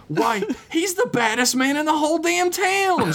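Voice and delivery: put-on high girl voice crying out. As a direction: cry it out, laughing. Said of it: "she cried out in response".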